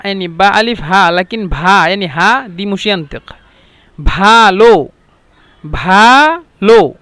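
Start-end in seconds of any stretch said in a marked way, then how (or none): 4.0-7.0 "بالو" mit behauchtem, schwerem bh, langsam und laut; "بالو" slowly and loudly, with a breathy bh at the start; heavy bh sound. Shown as a demonstration. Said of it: "با تقيلة شوية".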